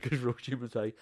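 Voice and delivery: usual speaking voice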